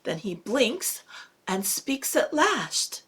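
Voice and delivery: ordinary delivery, nothing marked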